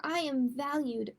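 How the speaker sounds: ordinary delivery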